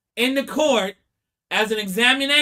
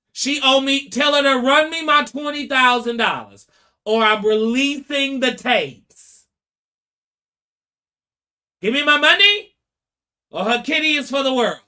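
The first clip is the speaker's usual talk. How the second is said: loud, higher mock threat, pushing the demand; hammed-up blackmail demand, raised and loud; threatening demand; 4 semitones higher, 4 dB louder than usual. Blackmailer threatening the husband over money, loud mock menace.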